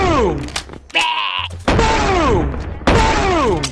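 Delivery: booming blast sound with the mouth; loud explosion noises. Pretend. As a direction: shout a big mouth-made explosion. Kids mimicking explosions in game.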